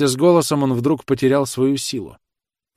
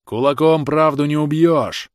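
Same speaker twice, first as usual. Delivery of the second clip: slow, loud, raised-voice defiance; defiant cry; 6 semitones higher, 5 dB louder, slower than usual. A narrator's reading. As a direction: cry it out firmly, word by word. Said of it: "крикнул Рыбин". (громкий вызывающий крик)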